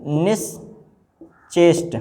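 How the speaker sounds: निश्चेष्ट drawn out slowly; slow dictation. Shown as a demonstration. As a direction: stretch निश्चेष्ट for writing. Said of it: "ये नहीं सही होता है".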